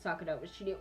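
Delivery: usual speaking voice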